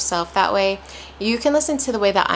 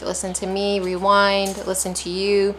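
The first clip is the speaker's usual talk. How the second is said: ordinary talk, nothing marked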